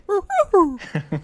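squeaky high falsetto ho ho ho; Mickey Mouse Santa. Pretend. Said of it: "Mickey Mouse as Santa Claus?".

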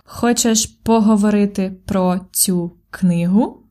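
"Хочеш поговорити про цю книгу?" said slowly, rising on "книгу"; slow rising question. Shown as a demonstration. Teacher models the answer for learners, slow with a rising tune. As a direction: spell it out slowly for learners and lift the end. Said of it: "The intonation goes up for questions".

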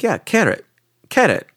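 Carrot said high for [name], as the mama says it; mispronounced name. Retold or imitated. Mama insisting his name is Carrot, high and certain. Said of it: "she couldn't say my name".

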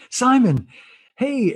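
high call across a crowd, pitch jumping up. Retold or imitated. Stranger in the crowd hails Simon, calling out high. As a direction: hail him by name over the noise.

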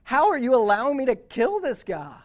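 loud, high, anguished retold plea; imitating a desperate cry to heaven; crying out to God. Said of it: "She's crying out to God".

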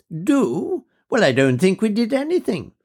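a lady of mature years, fondly remembering; high, fluting voice of an officer's widow; elderly widow. Acted. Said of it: "an officer's widow".

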